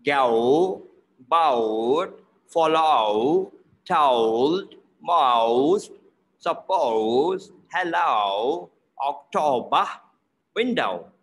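"Go" and "boat" drawn out from ah into rounded oh; "boat" as a long "ba-out", "window" as "win-dow"; exaggerated O diphthong. Shown as a demonstration. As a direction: stretch the O in "told", "most", "hello" as a dipping ah-oh glide. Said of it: "I will go through again".

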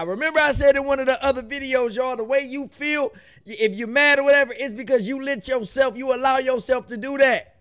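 high, fast and pumped, preaching to the camera; fired-up preaching. Real, not acted. Reactor lecturing viewers on anger, fired-up preaching. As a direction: sell the lesson like a hype man.

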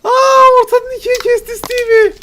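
very high, loud astonished cry; shocked shriek. Real, not acted. Watching Steve get cut in half, shrieking in shock. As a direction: shriek it in disbelief. Shocked reaction to the game violence.

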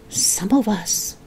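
slow throaty whisper; old woman whispering. Acted. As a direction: whisper it like a witch. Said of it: "whispering in a throaty sort of voice".

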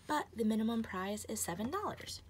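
normal talking voice

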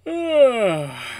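long, loud, high groan sliding down; waking groan. Acted. Wolf waking up from a nap.

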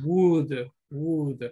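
would said as wood, each one separate; silent L. Shown as a demonstration. Teacher reminding the class, would with silent L. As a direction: drop the L in would. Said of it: "la O y la L es muda".

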